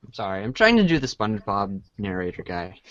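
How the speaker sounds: normal talking voice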